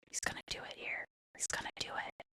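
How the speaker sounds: hushed excited whisper. Real, not acted. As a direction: whisper it like a secret.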